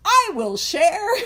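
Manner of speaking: put-on slow, high, loud drunken declaration; drunk Bill. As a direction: ham up a hammered man proudly volunteering. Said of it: "he was hammered and he's like".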